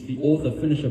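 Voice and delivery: normal talking voice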